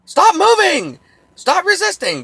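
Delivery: very high, loud screamed orders; mock cop yell. Cop yelling before firing, high loud screamed orders. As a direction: yell them as an officer about to shoot. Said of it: "When they start yelling".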